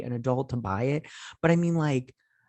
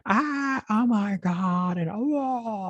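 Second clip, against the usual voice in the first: very high, drawn-out mock panic; mock screaming; 13 semitones higher, 3 dB louder, much slower than usual. Chaos as the prison wall breaks, mock screaming.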